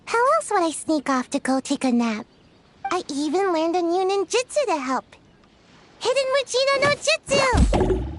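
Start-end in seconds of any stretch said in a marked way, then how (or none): 6.0-8.1 proud ninja-move shout; technique callout. Acted. Calls out ninja technique name.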